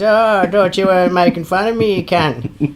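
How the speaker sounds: high, loud mock bogan. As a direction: ham up an ocker accusation. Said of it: "He goes".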